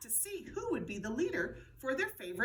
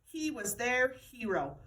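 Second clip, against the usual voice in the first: ordinary delivery, nothing marked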